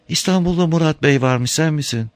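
high voiced old woman asking; timid old woman at the door; old woman's voice. A narrator's reading.

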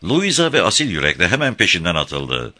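ordinary delivery, nothing marked

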